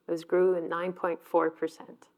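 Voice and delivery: ordinary delivery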